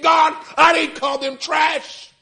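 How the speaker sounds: slow, high anguished shout to God; desperate shouted prayer. Retold or imitated. Grieving father crying out to God, slow anguished shout. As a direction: plead with God, shouting slowly.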